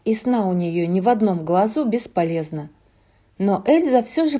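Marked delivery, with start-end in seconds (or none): none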